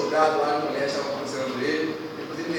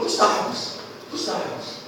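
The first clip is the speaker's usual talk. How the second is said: hushed prayer; 3 semitones higher, much slower than usual. Acted. Hezekiah's prayer to God, quoted.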